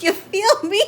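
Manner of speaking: high, hyped-up question in own accent; playful excitement. Real, not acted. First take, before the accent attempt.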